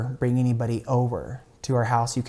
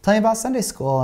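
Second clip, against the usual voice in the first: imitated concerned mom, pitched much higher; intuitive mother; 11 semitones higher than usual. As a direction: play a mother gently probing, voice lifted high. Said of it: "being the good intuitive mother".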